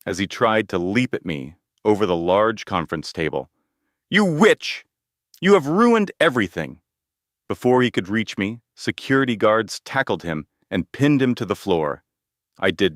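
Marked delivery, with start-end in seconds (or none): 4.1-6.8 high, loud enraged shout; furious yell. A narrator's reading. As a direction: hurl it at her, losing control. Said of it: "Michael yelled".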